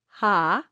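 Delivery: "ha" led in by a breath of H; gentle breath-first start on "ha"; easy onset with H. Shown as a demonstration. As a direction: let air flow before the vowel in "ha". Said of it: "a little bit of a H".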